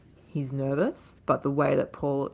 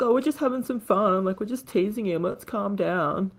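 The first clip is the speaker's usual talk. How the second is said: higher, fast scared muggers; nervous backpedal; 5 semitones higher, 3 dB louder than usual. Retold or imitated. Scared muggers backing off from Taviano, higher nervous excuses. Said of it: "And they're like".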